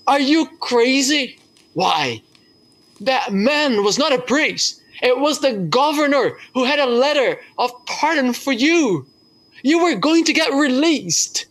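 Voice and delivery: high, exasperated scolding; incredulous warden. Retold or imitated. Prison warden scolds the prisoner in disbelief, high-pitched. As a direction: tell him he threw away his pardon.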